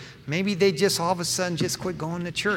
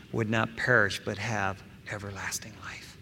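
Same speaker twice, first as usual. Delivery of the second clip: hushed whisper; 7 semitones lower, 8 dB quieter than usual. Acted. Preacher whispering the end of the verse to the church.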